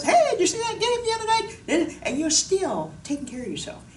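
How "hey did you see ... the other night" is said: high, loud and fast, laughing through it; chatty voice as if talking to a coworker mid-exercise; bubbly small talk with a laugh; casual chat. Pretend. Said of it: "you could be talking to somebody else".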